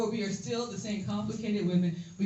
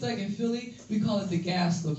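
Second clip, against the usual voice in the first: ordinary delivery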